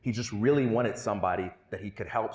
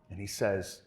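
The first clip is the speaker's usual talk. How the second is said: ordinary talk, nothing marked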